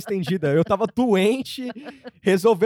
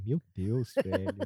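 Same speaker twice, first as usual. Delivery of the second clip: low, breathy pastor exclamation; 10 semitones lower, 7 dB quieter than usual. Retold or imitated. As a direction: exclaim like a preacher. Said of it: "o pastor não fala o V".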